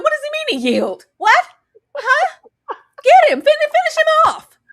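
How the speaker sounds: high, loud disbelieving cry; shocked outburst. Real, not acted. Reacting to the show, a high shocked yell.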